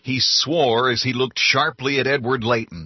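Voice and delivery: usual speaking voice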